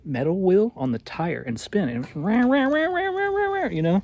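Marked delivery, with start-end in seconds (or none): 2.2-4.0 imitated dynamo whine. Telling spouse about the noisy bike light, imitated whirring. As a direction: buzz it out like a grinding wheel on a tire.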